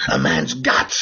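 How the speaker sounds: very low, slow tough-guy voice; hushed, deep cop drawl; Clint Eastwood. Acted. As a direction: rasp it out slowly in a low movie-hero voice. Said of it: "saying that Clint Eastwood put forward".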